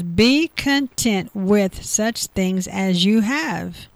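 very slow, deliberate, each word weighed; slow scripture reading. Real, not acted. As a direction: read it slowly so it sinks in. Reading scripture slowly, emphatically.

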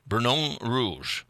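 slow, careful amateur French; attempted French pronunciation. Shown as a demonstration. Host showing the name known in France, careful French accent. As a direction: piece the French name together slowly. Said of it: "I don't say it very well".

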